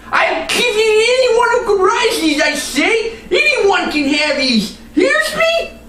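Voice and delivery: rage, covered in mud; raging villain. Acted. Muddy Nye rages at the horse.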